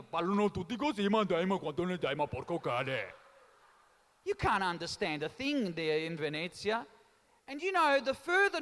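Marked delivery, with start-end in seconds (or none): 0.0-3.0 mock Venetian Italian dialect, sliding lower; Venetian accent. Mocking how Venetians speak to the Italian crowd, Venetian accent. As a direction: rattle it off in a comic Venetian accent. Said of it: "have you heard the Venetian accent".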